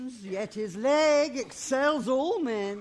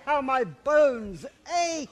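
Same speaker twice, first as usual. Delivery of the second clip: normal talking voice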